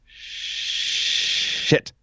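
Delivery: whispered curse, under the breath; imitating a commentator's muttered curse on air; Scott Hudson muttering. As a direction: let it slip out in a near whisper. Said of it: "you hear Scott Hudson just say".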